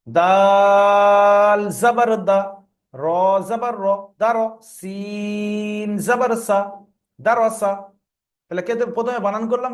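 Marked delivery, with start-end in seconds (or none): 0.1-6.6 slow recited spelling with long level notes on দাল and সিন; broken-up spelling. Shown as a demonstration. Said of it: "কেটে কেটে বানান". 7.2-7.7 দা র সা as short, even syllables; pronounced after spelling. Shown as a demonstration. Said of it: "তারপর উচ্চারণ করলাম".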